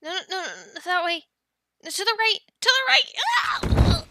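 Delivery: rising from urgent to a high loud yelp; frantic calling, ending in a cry; panicked warning. Acted. Rider yelling directions before a crash, panicked warning. Steering a clumsy dragon, alarmed.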